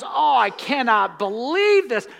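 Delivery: mock shocked disbelief. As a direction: act out being astonished, imitating someone caught off guard. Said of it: "Jesus is never surprised".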